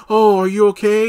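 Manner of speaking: high-pitched, overdone doting concern; mock simping. Aaron fussing over Karen as he says hello, mock simping. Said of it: "simping, simping hard".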